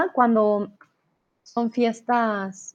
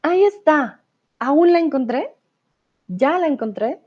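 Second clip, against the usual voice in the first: surprised; 6 semitones higher, 3 dB louder than usual. Pretend. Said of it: "Y de repente dices".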